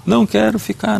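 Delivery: a high, pleading child's wail, lightly acted; crying child. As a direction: imitate a little boy begging through tears. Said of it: "Ele começou a chorar".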